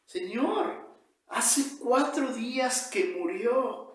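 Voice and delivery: high, pleading objection; protest. Acted. Martha, the dead man's sister, objects to Jesus, high protest. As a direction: object in rising pitch, drawn out. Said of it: "protestó".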